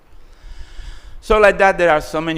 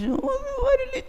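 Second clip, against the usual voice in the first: high, cracking cry of disbelief; startled squeak; 5 dB quieter than usual. Acted. Shiva stunned by his wife's demand, squeaking in disbelief. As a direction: yelp it out in shocked disbelief.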